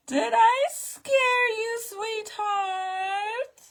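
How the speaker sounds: high, slow, giggly teasing; teasing laughing. Pretend. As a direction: tease sweetly through laughter.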